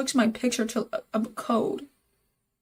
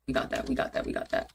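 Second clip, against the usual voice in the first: muttered to self; 12 semitones lower, 6 dB quieter, faster than usual. Real, not acted. Muttering relief after fix.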